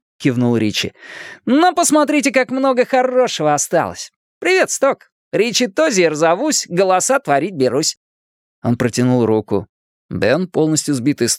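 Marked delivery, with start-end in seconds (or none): 1.4-8.0 put-on high-pitched chatterbox kid; cheeky, high boyish patter; wisecracking boy, showing off. A narrator's reading. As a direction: play the clownish show-off, pitched well up. Narrator plays wisecracking Richie.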